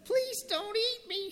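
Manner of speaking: very high, tiny squeak; squeaky mouse. Acted. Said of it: "squeaked the little brown mouse".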